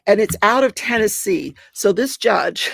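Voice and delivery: normal talking voice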